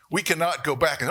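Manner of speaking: ordinary talk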